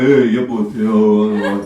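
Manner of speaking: low Bosnian throat sounds; hammed-up deep Bosnian grunts between words; guttural Bosnian talk. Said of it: "We make these guttural sounds".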